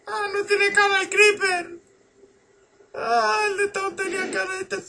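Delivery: very high squealed panic; susto agudo. Pretend. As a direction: squeal it in falsetto fright. Startled by the faceless creeper. (chillido agudo de susto)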